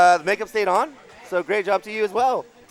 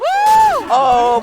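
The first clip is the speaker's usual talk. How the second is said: loud high oh; excited shout; 10 dB louder than usual. Real, not acted. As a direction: shout it out in shock. Caster reacting to a play.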